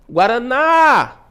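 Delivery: guaraná called out, final ná held long and high; calling out the word. Shown as a demonstration. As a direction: call guaraná like a name, stretching the ná. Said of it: "Estou chamando a palavra guaraná". (guaraná chamado, com o ná alongado)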